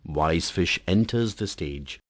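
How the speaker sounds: ordinary talk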